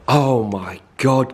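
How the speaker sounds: ordinary delivery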